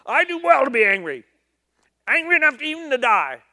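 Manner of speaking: loud, high, fast tantrum; fast shouted sulk, stamping mad; stomping angry Jonah. Acted. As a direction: throw a toddler tantrum at God. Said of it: "I could just see him stomping around".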